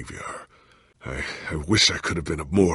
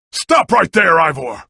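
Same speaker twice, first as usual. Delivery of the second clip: high, loud order to halt; shouted command; 10 semitones higher, 9 dB louder than usual. Acted. Hero yelling at Ivor to stop running. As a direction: stop him with a yell.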